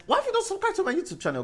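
fast, squeaky mock plea; high-pitched demand. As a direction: whine it quickly, very high. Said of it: "I'm like".

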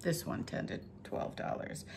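soft whispered afterthought; quiet aside. Real, not acted. Antique dealer noting a price under her breath, whispered. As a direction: drop to a whisper, half to yourself.

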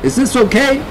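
high, called-out question; calling kid. A narrator's reading. Kid at the tiller to the cat, calling. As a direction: check by calling over the wind. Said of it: "the kid called".

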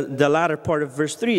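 ordinary talk

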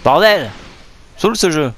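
frustrated shout. Real, not acted. Gamer raging at the game.

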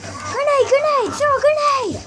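high urgent yelling; excited shout. Real, not acted. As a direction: shout it out in a rush of panic. Urgent call during firefight.

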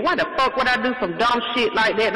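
ordinary delivery

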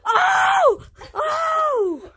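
high stretched-out shocked yell; shocked shout. Real, not acted. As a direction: scream it in disbelief. Astonished reaction to trick shot.

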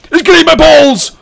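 very high, full-volume panicked scream. Real, not acted. Player under attack in game, screaming in panic. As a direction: shriek it in sheer alarm.

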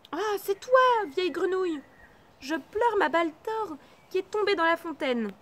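a crying princess calling out to the frog. Acted.

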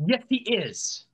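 high, drawn-out retort; stubborn contradiction. Real, not acted. Back-and-forth argument over Cockney.

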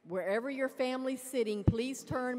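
usual speaking voice

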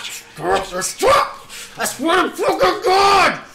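high, loud, shrieking fury; screaming rage. Acted. Escalating mock rage.

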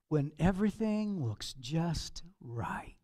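slow, hushed confiding whisper; a slow whispered aside to the congregation. Real, not acted. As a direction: let them in on a secret. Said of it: "whispered punchline".

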